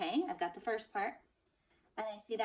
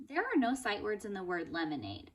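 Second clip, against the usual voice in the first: normal talking voice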